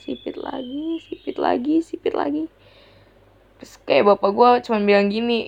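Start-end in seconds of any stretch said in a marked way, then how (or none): 0.0-2.5 exasperated repetition. Real, not acted. Exasperated complaint about slurs.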